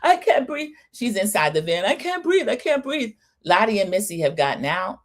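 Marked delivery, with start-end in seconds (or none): 0.0-0.7 high-pitched fake panic, imitating someone short of air; hammed-up gasping complaint in a high voice; mock hyperventilating panic. Recapper mimics Margot's panic attack in the van, mock panic. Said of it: "Margot's hyperventilating". 1.8-3.1 high, put-on panicky whine; mock hyperventilating panic. Recapper mimics Margot inside the van, mock panic. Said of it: "Margot's hyperventilating".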